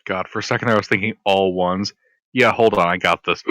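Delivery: ordinary talk, nothing marked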